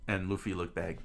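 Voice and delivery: ordinary delivery, nothing marked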